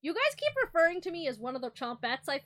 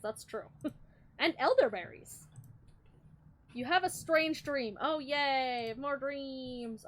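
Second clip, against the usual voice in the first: normal talking voice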